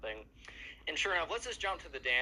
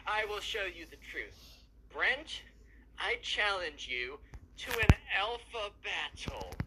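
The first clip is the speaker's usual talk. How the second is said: high, slow, grandiose proclamation; dramatic challenge; 7 semitones higher, 3 dB louder, slower than usual. Retold or imitated. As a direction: announce the alpha battle like a self-styled hero, dragging each word. Emo kid challenges Brent dramatically.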